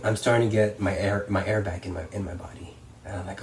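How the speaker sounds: ordinary talk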